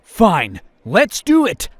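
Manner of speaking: loud, high, fed-up giving in; exasperated outburst. Acted. Provoked crusader giving in to the taunt, loud and exasperated. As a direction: throw up your hands and shout it.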